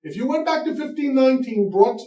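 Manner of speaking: normal talking voice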